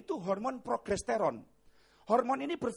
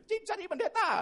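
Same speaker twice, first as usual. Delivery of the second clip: high call across the house; mock spouse's high holler; imitated calling out; 9 semitones higher, 3 dB louder than usual. Husband calls that someone seeks the pastor, high mock call. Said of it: "dia teriak".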